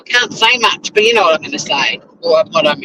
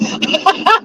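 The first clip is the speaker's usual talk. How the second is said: high laugh; 14 semitones higher than usual. Real, not acted. Laughs at commenter's joke.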